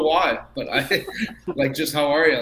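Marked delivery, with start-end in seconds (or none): 1.9-2.4 light Boston "How are ya?", ya clipped short; a quick Boston-style "How are ya?". Shown as a demonstration. Player asked for a Boston accent tries a mild Boston greeting. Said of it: "Like, Boston".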